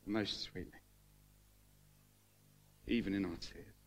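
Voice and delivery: choked up. Real, not acted. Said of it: "Sorry, I didn't mean to get emotional".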